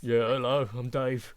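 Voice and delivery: loud, gruff deep-voiced greeting; low harsh voice. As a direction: boom it out like a gruff giant. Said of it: "they speak with a deep, gravelly voice".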